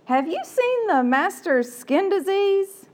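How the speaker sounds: high, slow, put-on servant voice; a servant whispering gossip late at night. Servant gossiping in the quarters at night, high and slow. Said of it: "here's what I think it might sound like".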